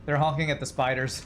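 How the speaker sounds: ordinary talk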